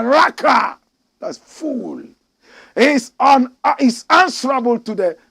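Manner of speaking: loud, high pulpit reading; fiery preacher quoting Matthew; preaching scripture. Acted. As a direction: thunder the verses at the flock. Reads scripture at preaching force.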